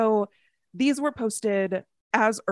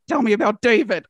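laughing; 4 semitones higher, 7 dB louder than usual. Retold or imitated. Excited fan demanding updates.

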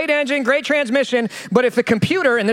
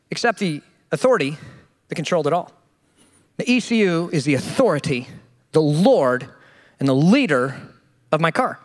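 solemn preacher; 7 semitones lower than usual. Acted. Preacher turning car trouble into a lesson, low solemn sermon tone. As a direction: lean in like a pastor revealing a truth.

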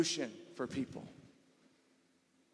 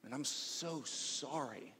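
much softer, slow and tender; gentle sympathy; 10 dB quieter, slower than usual. Shown as a demonstration. Pastor models comforting words to a hurting friend, soft and slow. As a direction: say it like you truly care. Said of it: "you might say something like".

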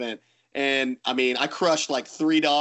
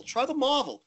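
put-on British accent; 10 semitones higher than usual. Bowler imitates Stu repeating his ball advice, British accent fading. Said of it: "in his British accent".

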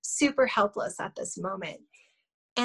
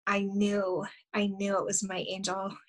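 ordinary talk, nothing marked

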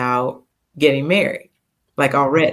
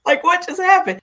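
stunned disbelief. Retold or imitated. Stunned by the surprise proposal.

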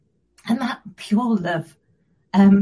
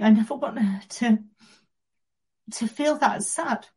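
choking up. Real, not acted. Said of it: "so I'm gonna get emotional".

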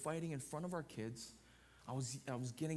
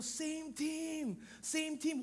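higher court call of same team; yelled call; 13 semitones higher, much slower than usual. Retold or imitated. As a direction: shout it to a teammate going for the ball. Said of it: "What do you yell?".

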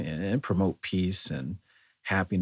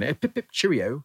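put-on goofy high-pitched British accent, much higher; bad British accent; 8 semitones higher, 5 dB louder than usual. Mock British accent to English friends, much higher. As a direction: ham up a silly posh English accent. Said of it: "What kind of accent are you doing?".

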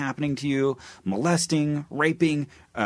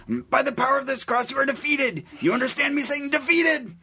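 much higher exorcist preacher voice; hammed-up high exorcist voice; Bob Larson impression. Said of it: "doing a Bob Larson impersonation".

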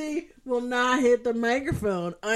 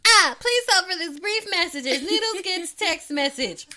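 high, loud joke announcement; mock announcer; 9 semitones higher, 8 dB louder than usual. Friend announcing an incoming text, mock announcer. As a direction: announce the interruption with silly importance.

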